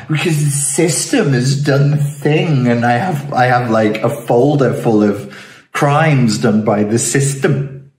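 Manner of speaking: hammed-up self-pitying excuse; mock victim whining. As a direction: plead the victim's case, mockingly. Mocking victim answers why.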